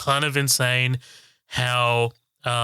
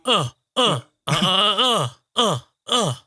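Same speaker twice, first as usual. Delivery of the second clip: mock sex moans; 7 semitones higher, slower than usual. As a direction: ham up rhythmic grunting moans. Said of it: "Is that how it went".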